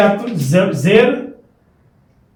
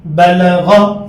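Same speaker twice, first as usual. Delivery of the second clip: बलगा drawn out very slowly, syllable by syllable; slow syllable drill; much slower than usual. Shown as a demonstration. Teacher to pupils, stretching बलगा out very slowly. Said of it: "जब तीन उनको मिलाएंगे तो क्या होगा".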